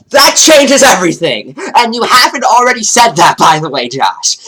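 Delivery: loud, exaggerated sarcasm; sarcastic. Real, not acted. Sarcastic outburst at Josh.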